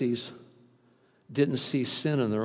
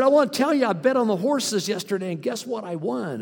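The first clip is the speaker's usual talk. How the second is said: higher, louder eager churchgoer voice, fading toward the end; eager congregant; 7 semitones higher, 5 dB louder than usual. Retold or imitated. Churchgoer boasting to the pastor, mock eager. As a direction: play the excited man confessing his bet. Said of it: "he said".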